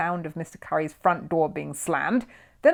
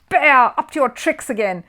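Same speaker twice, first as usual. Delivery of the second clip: loud, higher accusing yell; angry shout; 8 semitones higher, 11 dB louder than usual. A narrator's reading. Mister Curry catches Paddington again, angry shout. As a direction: rage at the bear for his tricks. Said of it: "he roared".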